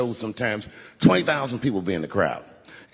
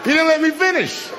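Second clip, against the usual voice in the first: high, loud laughing protest; laughing shout; 5 dB louder than usual. Acted. Comedian to the crowd, laughing loud and high. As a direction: yell it through laughter, mock outraged.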